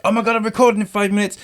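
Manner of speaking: high panicky rush; sudden flustered panic; panicked. Retold or imitated. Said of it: "I was kinda like".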